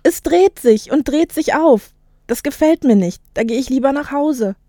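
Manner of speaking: startled youngster, pitch lifted; alarmed boy. Acted. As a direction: play a boy who wants out of here. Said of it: "Ein Junge kam auf mich zugelaufen".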